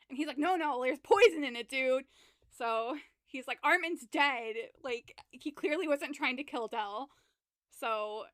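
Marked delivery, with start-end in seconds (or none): 0.4-2.1 loud, urgent panic; mock panicked yell; panicked shout. Panicked friend retold. 3.6-4.6 urgent exclaim. Retold or imitated. Panicked friend retold.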